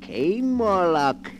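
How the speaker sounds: ordinary talk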